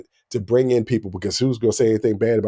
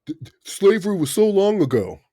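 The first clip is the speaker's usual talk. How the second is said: high, mock-dismissive voice of someone brushing off slavery; 8 semitones higher than usual. Mocking people who dismiss slavery to his sister, in a pitched-up parody voice. Mocking what others claim.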